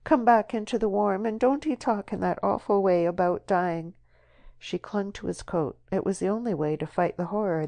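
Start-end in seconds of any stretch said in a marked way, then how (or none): 0.0-3.9 sobbing plea. Acted. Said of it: "sobbing and pulling his sleeve".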